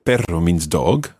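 usual speaking voice

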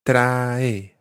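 "Trae" as two syllables, tra then e. Shown as a demonstration. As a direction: split "Trae" clearly into two beats. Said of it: "Take care with the word trae. It's got two syllables".

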